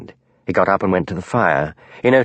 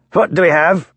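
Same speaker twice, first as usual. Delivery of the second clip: put-on a truculent Glasgow accent, higher and a touch louder; heavy Glasgow accent; 8 semitones higher, 3 dB louder than usual. A narrator's reading. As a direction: bark it like a bullying Glasgow inspector. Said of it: "in his heavy, truculent Glasgow accent".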